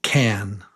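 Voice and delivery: can with a clipped "a"; short quick A. Shown as a demonstration. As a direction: say can short for a listening test. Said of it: "Short and quick".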